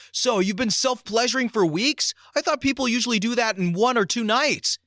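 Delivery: high, loud blurted retort; mock-loud outburst in church; very loudly. Kid blurting at the new pastor, loud and high. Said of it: "stand up and say very loudly".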